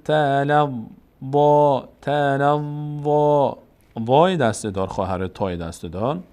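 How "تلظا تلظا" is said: "تلظا" chanted slowly with a heavy final aa; full heavy vowel. Shown as a demonstration. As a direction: draw out a full, rounded "ظا" in "تلظا".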